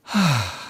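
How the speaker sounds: long slow sigh. Shown as a demonstration. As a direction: let the breath out slowly.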